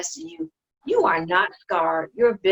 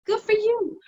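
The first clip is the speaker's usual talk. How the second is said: mock approval jumping much higher; sarcastic praise; 12 semitones higher than usual. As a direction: fake pat on the back, pitched up.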